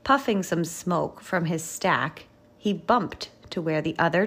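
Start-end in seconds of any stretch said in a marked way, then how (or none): none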